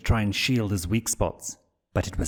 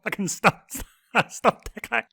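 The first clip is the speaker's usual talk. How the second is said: much higher, breathless laughing pleas; pleading through giggles; 12 semitones higher than usual. Acted. Blitz begging Stolas to stop tickling, giggling. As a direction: beg him to stop mid-giggle. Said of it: "His pleas were punctuated with even more giggles".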